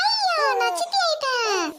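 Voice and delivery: high, drawn-out happy squeal; excited shout. Acted. Young woman learns she is an aunt, shouting with excitement. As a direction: blurt it out in uncontained eagerness. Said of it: "ஆர்வக்குள்ள கத்திட்டனே".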